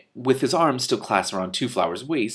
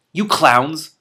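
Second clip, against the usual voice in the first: louder, higher angry yell; screamed; 5 semitones higher, 8 dB louder than usual. Acted. Hrun to the riders from a dragon, screaming in anger. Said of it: "screamed Hrun".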